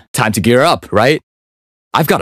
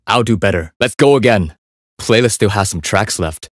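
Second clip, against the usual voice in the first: ordinary talk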